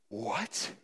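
gasp, then a hushed rising "what"; softly baffled, voice lifting; breath caught, quiet incredulous question; stunned disbelief. Acted. Servant's stunned reaction, acted.

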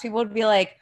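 normal talking voice

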